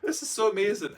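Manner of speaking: high, loud, crying gush; hammed-up high tearful squeal. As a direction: gush through mock tears. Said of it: "I remember bawling my eyes out".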